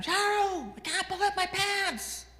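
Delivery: high, loud holler; mock yell. Dad calls from the bathroom to his wife, yelling. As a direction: yell it out like calling from another room. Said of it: "he yells from the bathroom".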